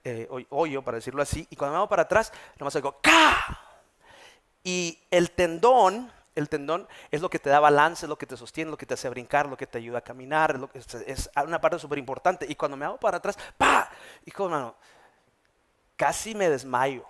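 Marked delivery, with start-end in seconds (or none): 3.0-3.6 sharp mouth-click of a tendon snapping; imitated snap. Pastor recreates the sound of his tendon tearing, a sharp click. 13.6-13.9 high, loud popping sound; imitated pop. Pastor mimics the moment his heel gave out, a loud high pop. Tendon snapping again.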